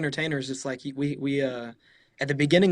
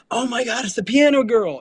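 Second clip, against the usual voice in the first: much higher, louder starstruck gush; excited fan; 9 semitones higher, 7 dB louder than usual. Retold or imitated. Guests spotting the pianist, much higher excited gush. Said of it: "a group of guests that are like".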